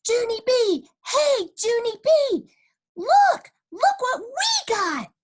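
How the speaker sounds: put-on high excited kid shouts from afar; hollering. A narrator's reading. Friends hollering across the playground, excited. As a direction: call out loud and high to get her attention. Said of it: "they hollered".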